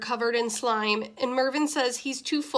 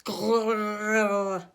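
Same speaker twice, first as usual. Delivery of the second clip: growl. Acted. Mister Bubbles growls at narrator.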